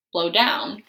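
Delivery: usual speaking voice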